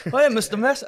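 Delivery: mock servant.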